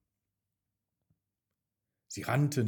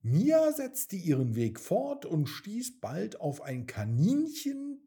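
ordinary delivery, nothing marked

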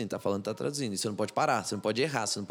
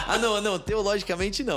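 laughing breathy denial, higher pitched; 9 semitones higher than usual. Real, not acted. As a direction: wave it off while still laughing. (negando entre risadas)

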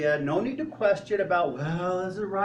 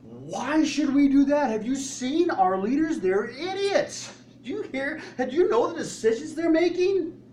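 mock whining complaint; 9 semitones higher, 4 dB louder than usual. Believers complaining to God, high and whiny. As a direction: whine like a grumbling congregation. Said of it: "we're crying".

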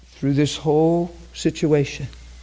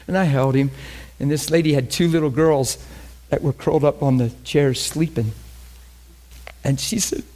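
usual speaking voice

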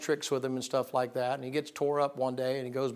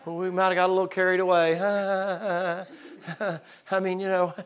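high, laughing, pleading excuses; guilty brothers begging, high and nervous; sheepish brothers; 6 semitones higher, 4 dB louder than usual. Retold or imitated. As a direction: wheedle and laugh it off. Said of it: "And they say".

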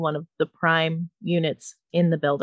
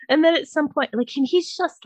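ordinary talk

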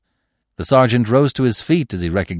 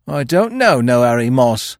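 higher, louder stubborn denial; dogged; 7 semitones higher, 6 dB louder than usual. A narrator's reading. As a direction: deny it doggedly. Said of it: "he muttered doggedly".

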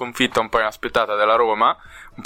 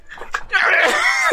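wild shriek, way up high; crazed high shout. Retold or imitated. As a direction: let out an unhinged scream. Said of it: "Spalletti ha fatto così". (urlo da psicopatico)

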